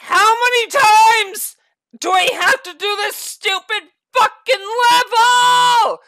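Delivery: loud, high, drawn-out angry words; exasperated, swelling angry protest; furious ranting. Real, not acted. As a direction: yell it at the game, hammering each phrase. Rant at delayed controls.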